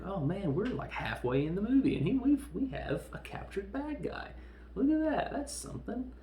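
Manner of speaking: high-pitched, exaggerated marveling; mock amazement. Mock amazement at early capture.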